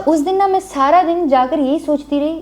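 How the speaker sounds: ordinary talk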